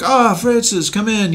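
put-on half-hearted Swedish accent; mock Swedish boss. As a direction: wave him in like a Swedish exec. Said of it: "Bludhorn's like".

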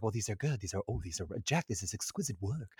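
soft, hammed-up awed heroine; Kate Winslet. Admiring Jack's sketchbook, soft mock-awed voice. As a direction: murmur it like a lightly mocked ingenue. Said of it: "she's like".

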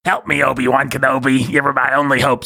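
lightly voiced princess; a hint of a cartoon princess; Princess Leia impression. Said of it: "the greatest and most accurate impression".

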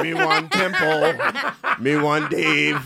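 slow, drawn-out mock Jamaican accent. Teasing a friend about his mom, Jamaican accent. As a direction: stretch each phrase in a comic Jamaican accent. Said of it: "Jamaican accent? Come on, you gotta go".

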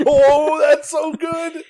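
high delighted laugh through words. Real, not acted. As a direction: squeal it out laughing. Laughing at the Royal Rumbler nickname.

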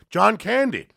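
high mocking yell of a name; high taunting call; mock heckler yell. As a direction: yell it up from a window like a jeering drunk. Heckler yelling from window.

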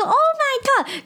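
high, held "Oh my god" in mock astonishment; amazed exclamation. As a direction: imitate grandma's shocked delight. Mother-in-law amazed.

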